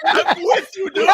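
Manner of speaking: high laughing words. Real, not acted. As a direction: talk through laughter. Laughing together.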